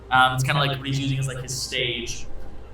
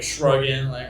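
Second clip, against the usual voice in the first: ordinary talk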